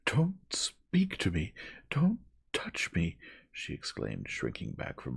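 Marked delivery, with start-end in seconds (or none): 0.0-3.2 high, loud, slowed-out frantic cry; frantic exclamation. A narrator's reading. Young woman shrinking back from all of them, frantic. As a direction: recoil in panic on each stressed word. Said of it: "in such a frantic manner".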